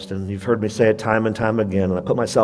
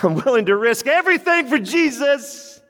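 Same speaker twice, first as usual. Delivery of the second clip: Paul's voice; 12 semitones higher than usual. Retold or imitated. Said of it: "He's like".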